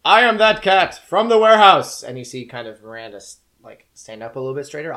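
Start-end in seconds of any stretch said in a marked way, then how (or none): 0.0-2.0 high, very loud declaration; booming cat voice. Acted. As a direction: proclaim it like a mighty talking cat. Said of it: "is this booming sort of".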